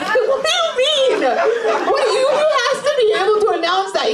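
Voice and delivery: high-pitched, drawn-out disbelief; incredulous. Real, not acted. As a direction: demand an explanation in high-pitched astonishment. Incredulous at audience member's head shake.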